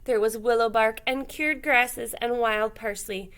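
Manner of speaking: plain reading voice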